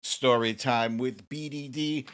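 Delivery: high, loud game-show welcome; show host intro. Acted. Host welcoming viewers to his show, loud game-show hype. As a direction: open the show big, selling every welcome.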